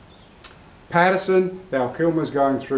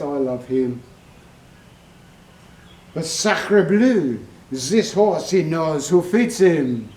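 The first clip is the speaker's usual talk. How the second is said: hammed-up French accent, opening slowly; playful bad French accent; mock French accent. Rider jokes about a horse like the trainer, mock French accent. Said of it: "It's a terrible French accent".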